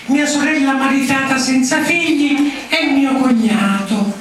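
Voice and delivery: put-on child voice. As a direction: read it like a small pupil. Child reads school essay aloud.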